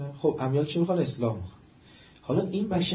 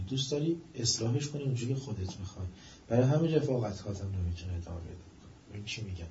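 ordinary talk, nothing marked

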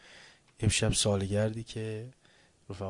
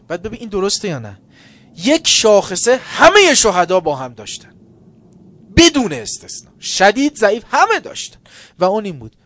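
loud, high impassioned sermon; fiery preaching; 11 semitones higher, 16 dB louder than usual. Acted. Preacher crying out to the congregation, fiery. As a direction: build to a shout.

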